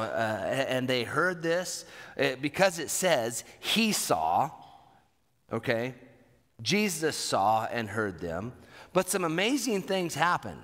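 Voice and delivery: normal talking voice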